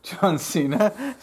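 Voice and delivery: amused, slow repeat of the name; laughing disbelief. Real, not acted. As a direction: laugh through the question.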